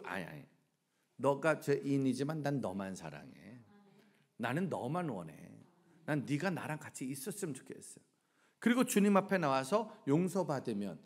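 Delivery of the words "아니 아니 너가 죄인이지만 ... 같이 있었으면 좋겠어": low, soft, loving appeal; tender reassurance. Acted. God answers an ashamed sinner, tenderly. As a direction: reassure gently and quietly.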